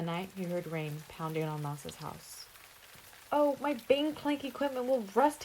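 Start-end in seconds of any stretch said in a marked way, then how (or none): 3.3-5.4 dismay. A narrator's reading. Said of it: "he thought in dismay".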